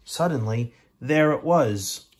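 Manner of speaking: slow, loud and rising; dramatic reveal. A narrator's reading. Narrator reveals the surprise slowly and loudly. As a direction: build suspense then land it.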